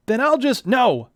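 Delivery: loud, high sudden cry of alarm; hero's cry. Acted. Neil reaching for his sword, crying out loud. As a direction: stop yourself mid-reach with a shout.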